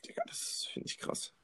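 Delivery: hushed whisper aside. Real, not acted. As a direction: drop to a breathy whisper. Aside after rant, voice drops.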